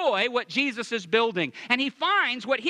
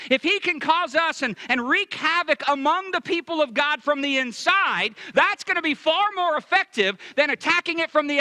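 fiery preaching; 5 semitones higher than usual. Acted. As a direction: drive it home to the congregation. Preaching at full force.

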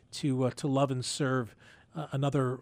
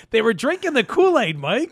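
laughing loudly through the joke; amused, teasing laugh in the voice; 8 semitones higher, 6 dB louder than usual. Real, not acted. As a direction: chuckle it out, higher and louder. Joking about fans' blind faith.

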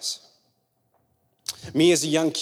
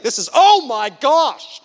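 burnt surprise; 8 semitones higher, 7 dB louder, slower than usual. Retold or imitated. Child touching hot iron.

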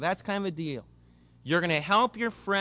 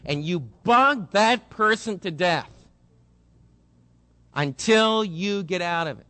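louder, urging the congregation hard; fiery preaching; 5 dB louder than usual. Acted. Preacher urging debtors to beg their way out, loud and fervent. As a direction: drive the point home like a revival preacher.

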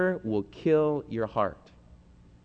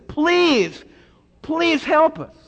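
high, loud desperate plea to God; anguished prayer, pitched high; pleading cry; 11 semitones higher, 4 dB louder than usual. Acted. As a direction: beg the Lord with a high, cracking voice. Preacher voicing a desperate plea.

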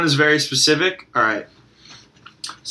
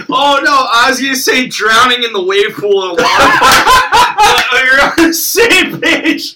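high, loud, laughing through words; laughing excitedly; 10 semitones higher, 7 dB louder than usual. Real, not acted. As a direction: laugh while blurting it out.

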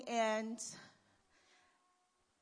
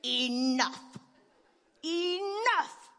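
higher, louder firm enough; uncle stepping in; 5 semitones higher, 6 dB louder, much slower than usual. Retold or imitated. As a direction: call off the parents like a rescuing uncle. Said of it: "Uncle Donnie comes in to say".

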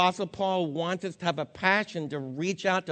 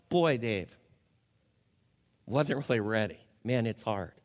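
low, subdued quote of someone grieving; retold weary grief; 8 semitones lower than usual. Retold words of a grieving woman.

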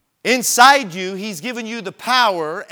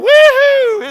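much higher, loud whoop of delight; joyful whoop; 14 dB louder than usual. Retold or imitated. Carl riding down the road with his feet out, loud joyful whoop. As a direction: let out a gleeful yell.

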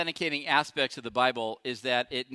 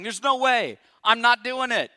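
loud, higher vehement protest; shouting Peter; 6 semitones higher, 7 dB louder than usual. Retold or imitated. Preacher as Peter shouting he will never fall. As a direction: shout the denial with full force. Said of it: "he's gotta be shouting".

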